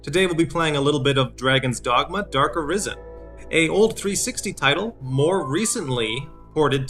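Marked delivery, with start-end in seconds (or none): none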